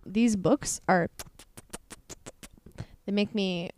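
usual speaking voice

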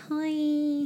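high squeaky hi. Retold or imitated.

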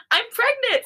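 high, loud excited outburst; squealed big reveal; sudden announcement. A narrator's reading. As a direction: blurt it out, giddy. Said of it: "Sammy says suddenly".

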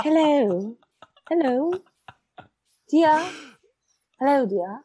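high, rising RP "Hello", H sounded; received pronunciation. Shown as a demonstration. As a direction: greet in put-on posh RP British. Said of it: "received pronunciation or Cockney".

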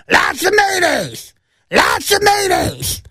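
high, slow, strained shout of a demanding woman; shrill shrieking customer yelling for mayo; screaming lady. Retold or imitated. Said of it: "That's exactly how her voice sounded".

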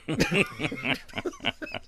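high-pitched burst of laughter; real high laugh. Real, not acted. Laughs at the story.

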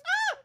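high mock scream of pain. Mimicking the screaming girl in the waiting room, mock scream. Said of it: "she's just screaming".

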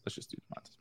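whispered under the breath; whispering. Real, not acted. Repeats the pick, then whispers.

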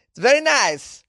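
Borat voice. Retold or imitated. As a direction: stretch out very like Borat. Borat catchphrase imitation.

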